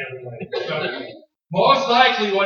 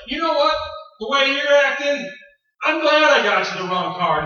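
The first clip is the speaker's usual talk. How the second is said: very high, loud, spiteful comeback; husband lashing out at wife, loud and spiteful; angry retort; 9 semitones higher, 7 dB louder than usual. Retold or imitated. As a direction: snap back in spite. Husband snapping back.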